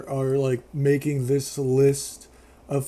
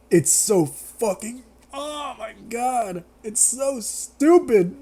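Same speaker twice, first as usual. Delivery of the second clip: high, drawn-out exasperation; slow, incredulous groaning complaint; exasperated disbelief; 7 semitones higher, slower than usual. Real, not acted. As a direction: stretch out each word in helpless disbelief. Exasperated at the film's stupidity.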